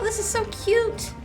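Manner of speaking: normal talking voice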